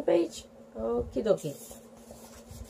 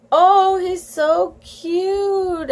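high, very drawn-out adoring coo; gushing cry; 7 semitones higher, 6 dB louder, much slower than usual. A narrator's reading. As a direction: gush over the baby, stretching it out high. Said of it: "Amanda cried".

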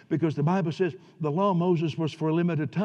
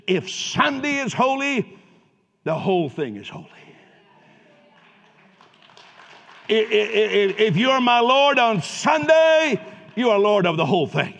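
preaching at full force; 5 semitones higher, 8 dB louder than usual. Acted. Pastor crying out to congregation, full-force preaching. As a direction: let it ring out over the church.

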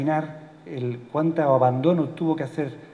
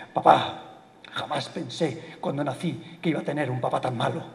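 ordinary talk

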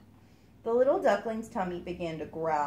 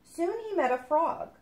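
ordinary delivery

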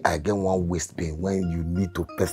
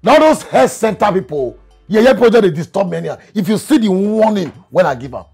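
raised, louder grumbling outburst; indignant rant; 6 semitones higher, 5 dB louder than usual. Acted. Man fed up with health center calls, indignant rant. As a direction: complain hotly about being pestered.

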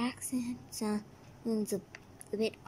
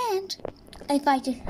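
ordinary delivery

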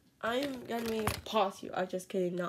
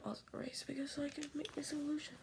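whisper; 3 semitones higher, 7 dB quieter, faster than usual. Real, not acted. Whispered aside.

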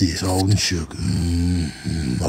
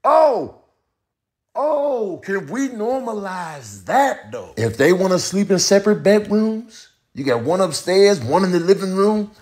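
high-voiced comic character; 11 semitones higher than usual. Making the case for couples, high character voice. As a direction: argue it way up in pitch.

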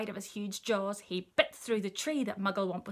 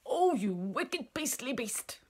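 slow, swooping outrage; indignant cry; 4 semitones higher, slower than usual. A narrator's reading. Elephant berates the crocodile, indignant cry. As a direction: draw out the insult in outrage. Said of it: "cried Chunky".

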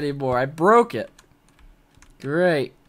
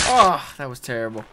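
ordinary talk

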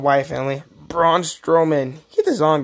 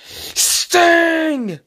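excited announcement; 11 semitones higher than usual. Real, not acted. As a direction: call the name like the wrestler is entering the ring. Announces the Sting figure.

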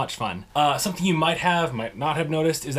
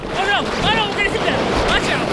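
high, loud warning calls; excited alarm; 6 dB louder, faster than usual. Real, not acted. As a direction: yell a warning as the sled veers. Sledding downhill, about to hit something.

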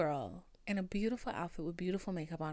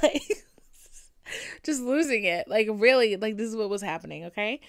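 excited laughing; 9 dB louder than usual. Real, not acted. Laughing at herself.

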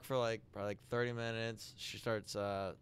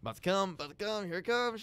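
urgent warning; 6 semitones higher, faster than usual. Retold or imitated. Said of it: "She keeps sucking".

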